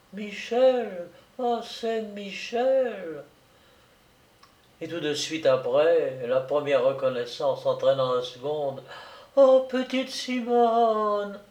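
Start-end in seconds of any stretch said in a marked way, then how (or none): 0.1-3.2 distant, slow, high and faint; soft echoing dream voice. Acted. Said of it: "d'une voix lointaine". 9.4-11.4 slow, high, distant wonder; soft echoing dream voice. Acted. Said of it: "d'une voix lointaine".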